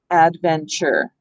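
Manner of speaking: slow, low "Adventure" in three parts; syllable by syllable. Shown as a demonstration. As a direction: sound out "Adventure" as ad, ven, chur. Sounding out the divided syllables.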